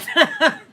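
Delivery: loud burst of laughter; real loud laugh at herself. Real, not acted. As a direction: crack up out loud. Laughing at her own story.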